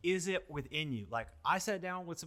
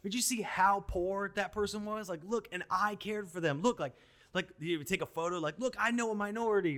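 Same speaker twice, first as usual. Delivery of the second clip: mocking self-praise; 6 semitones higher than usual. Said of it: "we're like".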